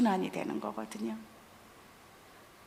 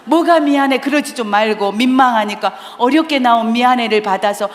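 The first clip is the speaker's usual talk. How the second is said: loud spousal spat; 2 semitones higher, 10 dB louder than usual. Acted. Acting out a couple who argue over an apology, loud bickering. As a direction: play the bickering couple at full volume. Acted quarrel resumes.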